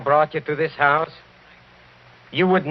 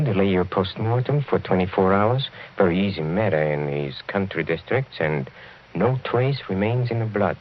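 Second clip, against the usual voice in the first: normal talking voice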